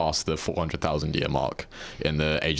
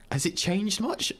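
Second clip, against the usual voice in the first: incredulous; 10 semitones higher than usual. Real, not acted. Disbelieving reaction to the news.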